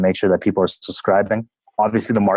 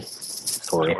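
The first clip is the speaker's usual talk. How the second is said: whispered sorry; 3 semitones lower, 10 dB quieter than usual. Pretend. Joke about Canadians saying sorry.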